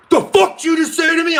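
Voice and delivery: high, loud mock snarl; imitating a hothead yelling right in his face; imitating angry shout. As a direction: play the hothead squaring up. Friend gets in his face.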